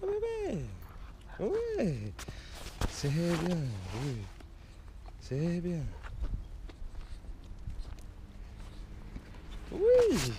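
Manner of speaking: very high, soft, cooing voice. Real, not acted. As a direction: coo tenderly at the puppy. Cooing to the puppy.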